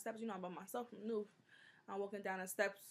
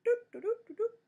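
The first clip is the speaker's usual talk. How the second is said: tic sound; 7 dB louder than usual. Retold or imitated. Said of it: "someone said".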